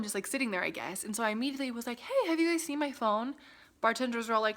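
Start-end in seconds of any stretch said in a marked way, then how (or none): 2.0-3.4 higher-pitched, polite-sounding query; retold question. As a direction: call over staff with a question, pitched up. Retells asking bartenders for phone.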